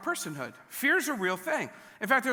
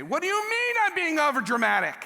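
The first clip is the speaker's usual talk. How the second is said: higher, indignant family catchphrase; mock indignation; 7 semitones higher, 3 dB louder than usual. Family joke line quoted with mock indignation. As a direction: play it as a huffy protest. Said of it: "We have this thing that we say".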